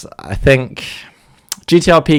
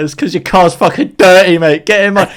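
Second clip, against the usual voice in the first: cheeky taxi driver; 7 semitones higher, 5 dB louder than usual. Retold or imitated. Taxi rivalry retold quote.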